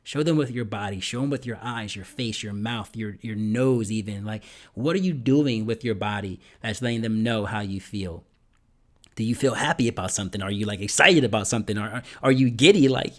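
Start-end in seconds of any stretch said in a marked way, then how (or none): none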